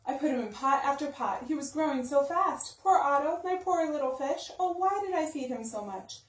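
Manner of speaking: ordinary delivery, nothing marked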